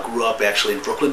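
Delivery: ordinary delivery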